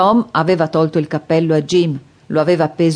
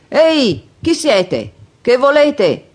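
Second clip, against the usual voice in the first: raised, high-pitched challenge; calling out; 7 semitones higher, 3 dB louder, slower than usual. A narrator's reading. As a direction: call out to whoever is hiding, demanding an answer. Jim challenges unseen intruders. (richiamo acuto e perentorio)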